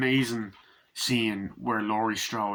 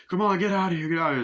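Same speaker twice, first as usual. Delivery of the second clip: mock shooing shout; 8 semitones higher, 4 dB louder, faster than usual. Man chasing kids off a house.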